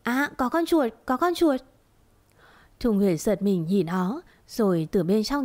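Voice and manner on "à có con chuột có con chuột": high startled cry, pitch leaping up; shrieking about a mouse. A narrator's reading. Maid fakes a fright to cause a commotion, shrill cry. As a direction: squeal it out as if a mouse ran past. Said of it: "nhảy cẫng la toáng lên".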